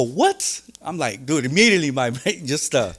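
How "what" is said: high disbelieving what; stunned what. Retold or imitated. Inmate hearing he is free, high and stunned. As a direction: gasp out the question. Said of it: "I go".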